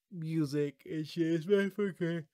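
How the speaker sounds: words swallowed by a yawn; real yawning. Real, not acted. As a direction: yawn through the line, then apologize. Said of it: "I do not know why I am yawning".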